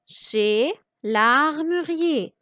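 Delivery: "chez l'armurier" drawn out slowly; slow, syllable by syllable. Shown as a demonstration. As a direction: read "l'armurier" slowly for learners. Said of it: "Je découpe le mot". (« chez l'armurier » lu lentement)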